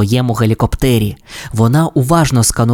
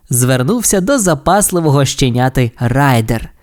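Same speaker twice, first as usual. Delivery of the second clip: ordinary delivery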